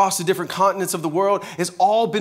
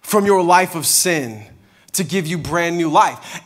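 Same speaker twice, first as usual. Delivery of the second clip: tender Jesus; 5 semitones lower than usual. Acted. Jesus tenderly calling him.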